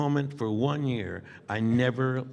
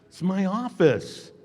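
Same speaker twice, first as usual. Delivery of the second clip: insistent; 8 semitones higher, 4 dB louder, slower than usual. Retold or imitated. Retold insistence.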